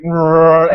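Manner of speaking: long, loud rolling growl; imitated zombie growl. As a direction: growl like the freshly undead. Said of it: "gets right back up and he's like".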